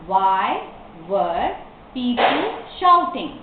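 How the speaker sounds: slow question with clear gaps between words. Shown as a demonstration. Teacher repeats the question for learners, word by word. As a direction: separate "Why", "were", then the rest.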